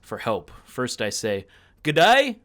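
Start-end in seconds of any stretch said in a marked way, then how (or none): 1.8-2.4 broad Aussie greeting, called out high and loud; Australian accent. Acted. Player calling for help down the doorway, in an Aussie accent. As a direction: shout a cheery Aussie hello down the passage. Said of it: "I have an Australian accent".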